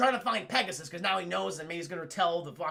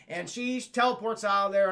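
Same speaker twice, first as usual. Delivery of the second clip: normal talking voice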